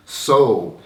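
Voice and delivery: normal talking voice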